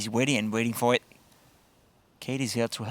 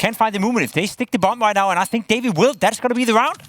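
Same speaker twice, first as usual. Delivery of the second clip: excited; 10 semitones higher, 6 dB louder than usual. Real, not acted. Tense clutch, caster hyped.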